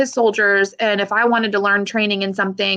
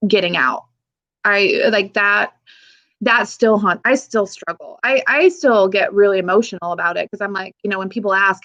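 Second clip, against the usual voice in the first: ordinary talk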